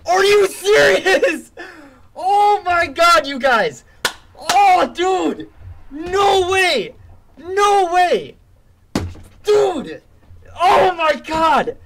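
high excited disbelief shouts; excited screaming. Real, not acted.

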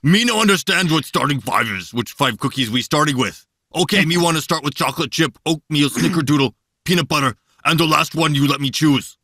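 growly monster puppet voice; earnest cookie-loving monster talking. Acted. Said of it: "Your, your Cookie Monster is".

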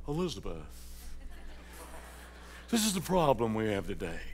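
imitating a soft, low, composed address; deliberately understated, as if nothing were urgent; mock-calm formal talk to a wife; mock sophisticated restraint. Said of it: "I ain't never talking like that".

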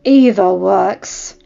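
slow, loud grim growl. A narrator's reading. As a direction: growl it out darkly. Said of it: "Robert growled".

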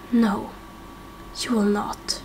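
low, breathless threat; whispered menace; menacing whisper. Acted. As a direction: hiss the denial under your breath. Villain's menacing reply.

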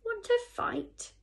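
very high, loud challenge; cocky dare, pitched right up; bad-tempered challenge. A narrator's reading. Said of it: "said the bad-tempered ladybird".

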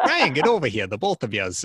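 loud imitated Boston boss call; Boston accent. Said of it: "thickest, most stereotypical Boston accent".

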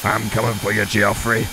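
low menacing. Pretend. Menacing threat in game.

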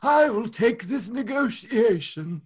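put-on old negotiator, quavering; old person voice. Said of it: "your old person voice sounds like".

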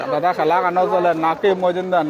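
ordinary talk

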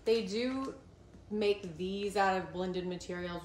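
ordinary delivery

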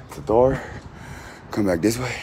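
ordinary delivery

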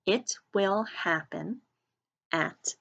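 slow, word by word for joining in; deliberate, evenly spaced teacher dictation; leading choral repetition. Shown as a demonstration. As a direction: lead learners through each word with pauses. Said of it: "Say that with me".

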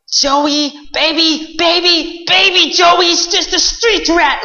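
mock the prince like a haughty queen; scolding queen. Queen scolds in told story.

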